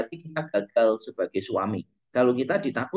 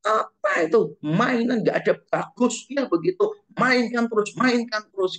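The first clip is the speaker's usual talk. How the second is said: higher, scolding parent nagging; angry parent; 8 semitones higher than usual. Retold or imitated. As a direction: nag sharply about the phone. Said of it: "paling marah".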